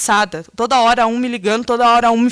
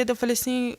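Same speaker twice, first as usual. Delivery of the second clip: usual speaking voice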